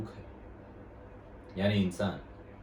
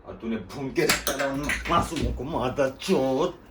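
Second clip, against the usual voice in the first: higher, seething voice, dragging out the final curse; enraged accusation; 3 semitones higher than usual. Acted. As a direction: accuse in rising rage, stretch the swear word. Acted rebuke over killing innocents. (गुस्से से भरा आरोप, गाली खींचकर)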